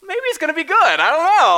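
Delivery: the hesitant voice of someone catching the excitement; unsure would-be fan. Retold or imitated. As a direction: play a doubtful friend half won over. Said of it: "you're kinda like".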